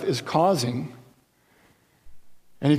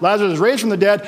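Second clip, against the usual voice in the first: ordinary talk, nothing marked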